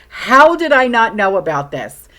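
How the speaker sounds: loud, high disbelief; amazed how did I not know. Retold or imitated. Vlogger recalling her reaction to a clever trick, loud amazement. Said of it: "I'm like".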